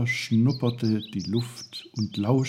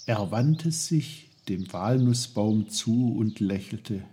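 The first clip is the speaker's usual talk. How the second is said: ordinary delivery, nothing marked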